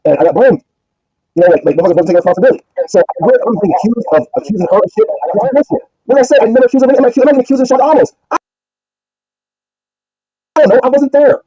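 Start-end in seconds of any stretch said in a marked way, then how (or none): 3.5-11.4 heated protest. Real, not acted. Defending himself, heated.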